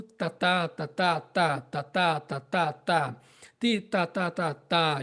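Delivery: tá, tá, tá with the hardest, punchiest attack; strong "tá" articulation drill; articulated tá syllables. Shown as a demonstration. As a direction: bite each "tá" sharply. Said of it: "mais intenso do que o tá".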